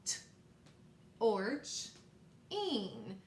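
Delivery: "T, orch, ing" with long pauses between parts; word in parts. Shown as a demonstration. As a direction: sound out "T, orch, ing", the T as a bare consonant. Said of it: "Let's do it again".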